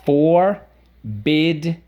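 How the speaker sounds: "Forbidden" in three long separate beats, FOR, BID, DEN; syllable by syllable. Shown as a demonstration. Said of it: "Let's go".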